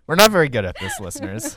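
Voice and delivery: laughing while talking. Real, not acted. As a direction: giggle through the words. Laughing at own bad French.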